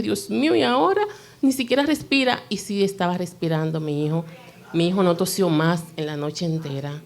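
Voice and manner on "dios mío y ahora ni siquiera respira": high, frightened gasping question; sudden fear, voice shooting up; panicked cry. Retold or imitated. Mother checking her still child, high panicked fear. Fearing child not breathing.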